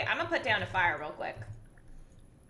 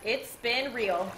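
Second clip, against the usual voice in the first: slow dramatic farewell; much slower than usual. Pretend. Farewell to the cave, drawn out.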